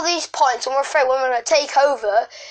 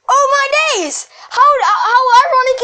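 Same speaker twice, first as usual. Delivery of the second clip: exasperated exclamation; 11 semitones higher, 6 dB louder than usual. Real, not acted. Exasperated outburst at the irony.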